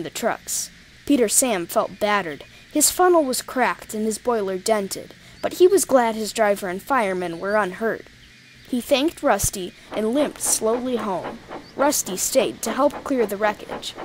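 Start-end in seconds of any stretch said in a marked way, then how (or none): none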